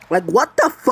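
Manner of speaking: loud, high disbelieving shout; ranting at the world, high loud disbelief; exasperated outburst. Real, not acted. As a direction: throw up your hands, what the fuck. Ranting about a crazy world.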